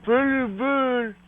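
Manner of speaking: high voice for a pet bird; high bird talk. Shown as a demonstration. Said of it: "Go up and talk to Red".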